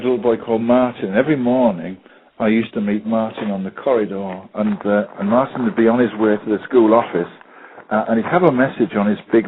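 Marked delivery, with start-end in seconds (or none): none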